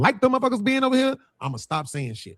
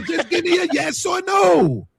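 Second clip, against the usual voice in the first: slow, much higher, put-on shouted voice; imitating a friend's yell; 12 semitones higher, slower than usual. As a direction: mimic the friend's demand, drawn out. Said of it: "I can't do the Portuguese voice".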